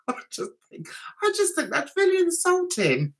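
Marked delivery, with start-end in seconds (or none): 0.1-0.9 whisper. Real, not acted. Whispered aside.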